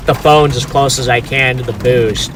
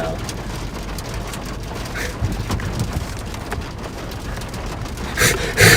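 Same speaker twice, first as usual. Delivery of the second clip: long silent laugh; 9 semitones lower, 10 dB quieter than usual. Real, not acted. Dad backs into Connor.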